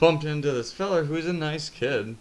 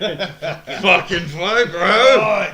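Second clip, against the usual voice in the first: laughing hype; 5 semitones higher, 12 dB louder, much slower than usual. Retold or imitated. Laughing retelling of fight.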